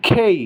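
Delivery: খেই opening like Bengali খ; aspirated K. Shown as a demonstration. Teacher modelling the correct letter K, aspirated. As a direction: puff air on the kh of খেই. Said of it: "খয়ের মতো উচ্চারণ হবে".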